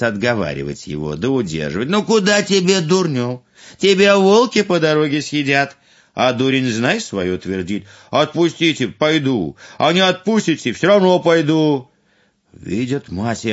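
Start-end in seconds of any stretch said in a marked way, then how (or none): 1.9-5.8 higher, louder scolding parent. Acted. 8.1-11.9 higher, louder stubborn youth; insist, won't back down; stubborn fool. Acted.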